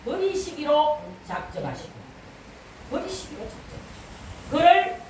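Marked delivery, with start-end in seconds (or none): 2.9-4.5 very soft, whispered echo; hushed repeat. Real, not acted. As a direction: drop to a whisper for gravity. Same phrase repeated, hushed. (속삭이듯 낮춘 목소리)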